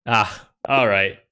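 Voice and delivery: ordinary talk, nothing marked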